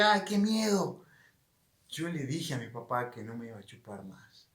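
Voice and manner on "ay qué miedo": mock fright. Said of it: "No el temor de que".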